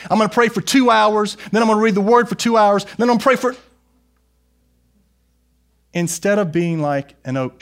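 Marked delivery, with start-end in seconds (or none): 0.1-3.5 high, loud, fast excited plans; mock overeager. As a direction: rattle off grand plans, hyped. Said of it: "because you're like".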